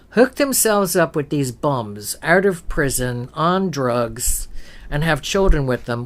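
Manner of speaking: ordinary talk, nothing marked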